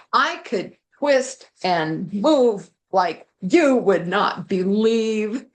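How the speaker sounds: ordinary delivery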